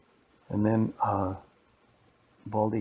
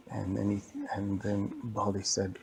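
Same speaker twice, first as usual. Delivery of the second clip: ordinary delivery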